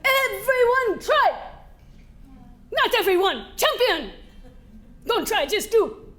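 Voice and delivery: loud, high coach's bark, slow on the key lines; stern coach shouting. Retold or imitated. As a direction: drill it into the athlete. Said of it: "he said".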